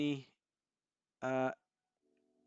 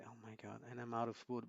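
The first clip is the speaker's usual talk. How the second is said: usual speaking voice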